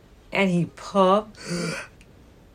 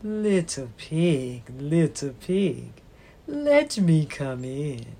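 wolf voice; 4 semitones lower, 3 dB quieter than usual. Acted. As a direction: growl it softly like a wolf. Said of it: "came along and said".

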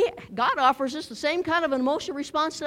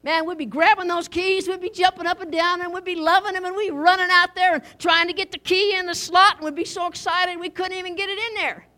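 ordinary delivery

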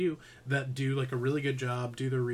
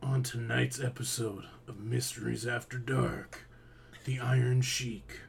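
low voice. Pretend. Introducing tonight's mysteries episode in a hushed low voice. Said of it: "to do the low voice".

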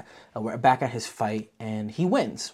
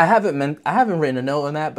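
ordinary delivery

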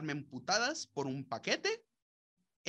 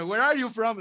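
a little higher and much louder, nosy suburban lady; 6 semitones higher, 11 dB louder than usual. Acted. Suburban woman demands where a stranger is from, loud and nosy. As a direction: bark the question like the nosy neighbour.

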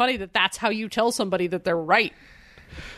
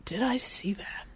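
soft, near-whispered question; half-whispered wondering; musing to self; 6 dB quieter than usual. Real, not acted. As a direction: ask yourself under your breath. Musing over a film question.

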